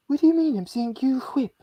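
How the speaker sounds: high, indignant cartoon baby voice; Stewie Griffin impression. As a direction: Whip said as hwip, high and offended. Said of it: "pulled off a Stewie Griffin".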